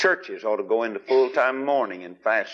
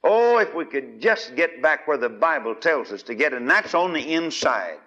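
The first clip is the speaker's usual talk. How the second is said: normal talking voice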